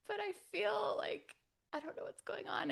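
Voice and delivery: put-on high, wailing child voice; little-kid whine, high pitched; whiny little kid. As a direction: plead like a lost little kid.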